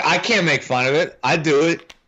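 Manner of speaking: ordinary talk, nothing marked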